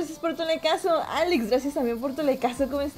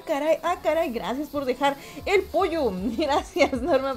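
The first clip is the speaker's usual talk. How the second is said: ordinary talk, nothing marked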